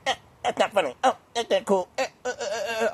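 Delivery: mock a humorless person balking in little stuttered grunts; overly sensitive killjoy. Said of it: "overly sensitive".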